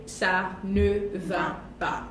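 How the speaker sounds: "ça ne va pas" said very slowly; slow model. Shown as a demonstration. Teacher slows down to model ça ne va pas. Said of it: "and ça ne".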